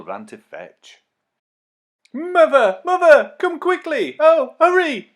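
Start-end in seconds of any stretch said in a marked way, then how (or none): 2.1-5.1 high, loud, urgent shouts for mother; urgent calling. Acted. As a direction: call her mother to come quickly. Girl calls her mother urgently.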